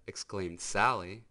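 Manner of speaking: usual speaking voice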